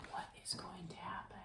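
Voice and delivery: soft, breath-only whisper; hushed suspense. Pretend. Suspense question to young listeners.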